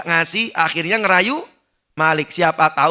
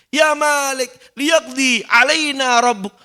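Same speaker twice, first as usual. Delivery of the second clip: higher, louder desperate pleading; begging sinner; 8 semitones higher, 3 dB louder, slower than usual. Acted. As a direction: beg to be let out or killed. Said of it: "Ngomong dong sama Tuhanmu".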